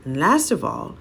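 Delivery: ordinary delivery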